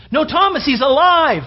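excited urging to a doubting friend; insistent disciples. Acted. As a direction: plead with him that it's true.

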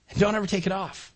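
usual speaking voice

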